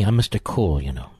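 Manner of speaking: a lightly put-on low voice; mocking impersonation. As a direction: drop low and drag it out as a self-satisfied Mister Cool. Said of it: "Listen to my voice. It's even changing".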